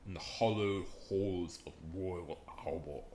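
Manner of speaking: put-on British with stretched vowels; mock British accent. Shown as a demonstration. Streamer repeating a wrestler's famous line, mock British accent. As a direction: savour it in a fake British accent. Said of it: "uh, perfect British accent".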